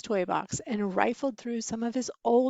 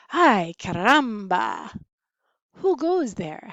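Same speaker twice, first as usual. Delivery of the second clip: Spanish-flavoured exclamation; 6 dB louder than usual. A narrator's reading. Skippy meets the bandits.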